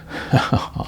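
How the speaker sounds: a short, breathy little laugh; a brief quiet laugh at the insult; small laugh. Acted. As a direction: chuckle softly, shrugging it off. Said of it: "He laughed a small laugh".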